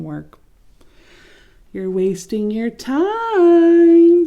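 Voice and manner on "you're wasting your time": higher, louder and very slow mocking singsong; taunting. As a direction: drag out the words to rub it in. Leaves taunting Leaf.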